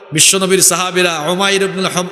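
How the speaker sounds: ordinary delivery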